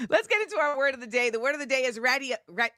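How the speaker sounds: usual speaking voice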